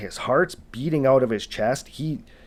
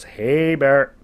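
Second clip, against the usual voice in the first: retold call-out, stretched and raised; 2 semitones higher, 5 dB louder than usual. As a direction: hail a bear from a little way off. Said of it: "So again, he goes".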